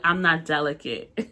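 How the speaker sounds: normal talking voice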